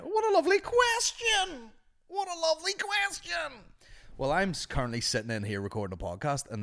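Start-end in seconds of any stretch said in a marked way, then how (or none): 0.0-3.6 very high, loud mock-delighted squeal; hammed-up falsetto delight; put-on gushing voice. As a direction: gush over it in a silly high voice. Sudden put-on voice answering a question.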